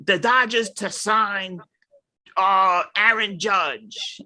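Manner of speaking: mocking clickbait rumor. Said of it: "that's like you".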